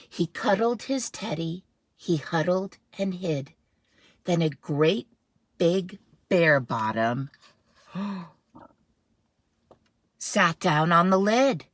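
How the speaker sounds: put-on dramatic read-aloud for kids; storybook narration. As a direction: read it with suspense. Dramatic picture-book narration.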